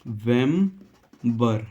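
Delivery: नवंबर very slowly as English "ne ... vem ... ber"; syllable by syllable. Shown as a demonstration. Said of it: "नवंबर को हम कैसे लिखेंगे".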